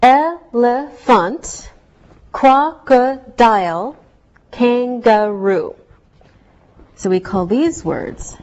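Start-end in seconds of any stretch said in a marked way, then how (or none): 0.0-5.7 Elephant, crocodile, kangaroo drawn out slowly, each syllable clear; slow syllable demo. Shown as a demonstration. Teacher to class, showing three-syllable words slowly. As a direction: stretch each word out so every syllable is heard. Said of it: "we hear three vowel sounds".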